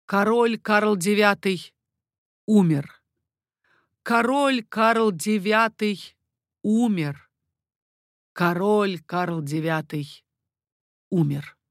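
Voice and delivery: slow, stretched herald's cry; proclaiming. A narrator's reading. Guard chief on balcony announces the death, proclaiming. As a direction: proclaim slowly, pausing before the final word. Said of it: "три раза крикнул".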